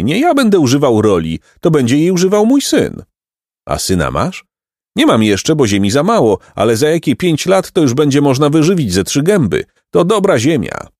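put-on high, bright-hearted old peasant voice; cheerful peasant. A narrator's reading. Peasant reassures the two travelling boys, high and kindly.

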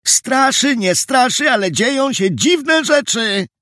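high-pitched shout from the sidelines; excited high call. A narrator's reading. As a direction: throw it in from the side of a crowd, voice way up. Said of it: "Ktoś z boku zawołał".

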